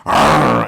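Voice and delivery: loud mock lion roar; lion's roar. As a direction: roar suddenly like an attacking lion. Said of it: "And he goes".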